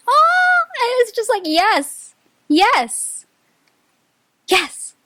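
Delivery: high, loud, delighted yeses; real excitement bursting out; thrilled. Real, not acted. Reader cheering a card for group three, thrilled and loud. Reacting to tarot cards.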